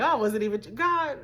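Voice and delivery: ordinary talk, nothing marked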